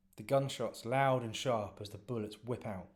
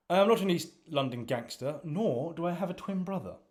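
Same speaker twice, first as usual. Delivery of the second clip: indignant Reginald; 7 semitones higher, 8 dB louder than usual. Acted. Reginald indignantly corrects Cookie.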